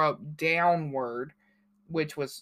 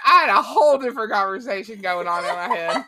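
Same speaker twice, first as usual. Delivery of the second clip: laughing; 4 semitones higher, 6 dB louder, faster than usual. Real, not acted. Laughing at her own mix-up.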